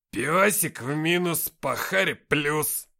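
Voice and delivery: loud, drawn-out growling complaint; growled. A narrator's reading. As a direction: growl it out slowly over the spilt beer. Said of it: "прорычал Никита". (рыча, громко и протяжно)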